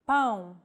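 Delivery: "Pão" with a nasal diphthong; "Pão", vowel through the nose. Shown as a demonstration. Teacher showing the tilde, nasal vowel. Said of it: "To indicate nasal vowels".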